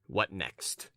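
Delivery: low, soft, curt question; curt impatience. A narrator's reading. Young man hurrying the rambling stranger, curtly impatient. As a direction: cut him short in a low voice. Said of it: "what a tone you take".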